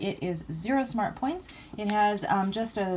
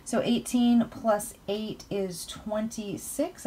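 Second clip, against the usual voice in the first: normal talking voice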